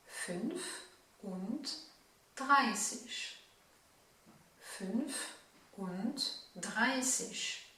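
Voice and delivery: "fünfunddreißig" drawn out syllable by syllable; very slow demo. Shown as a demonstration. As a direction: spell out "fünfunddreißig" for learners, very slowly. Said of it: "Nous le dirons". (« fünfunddreißig » syllabe par syllabe, très lentement)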